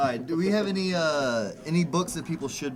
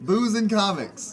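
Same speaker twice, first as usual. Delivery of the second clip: laughing; 4 semitones higher, 6 dB louder, slower than usual. Real, not acted. Joking about Pat's loves.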